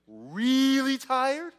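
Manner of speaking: REALLY stretched long, high and loud; hugely elongated "really" before "tired"; exaggerated emphasis. Shown as a demonstration.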